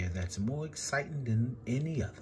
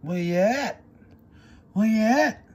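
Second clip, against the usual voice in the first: a call that builds louder and higher; musicians calling out; 7 semitones higher, 6 dB louder than usual. A narrator's reading. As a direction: call out over the parade. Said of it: "the musicians would call".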